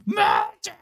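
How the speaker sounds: imitated distant scream; echoing cry. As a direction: mimic a far-off echoing shout. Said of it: "you can just hear the echoing of".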